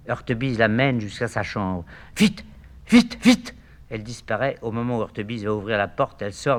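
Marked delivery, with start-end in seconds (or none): 2.2-3.5 urgent. Acted. Urging him off.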